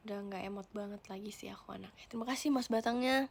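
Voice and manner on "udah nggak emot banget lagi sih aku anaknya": whisper. Real, not acted. Whispered aside about emojis.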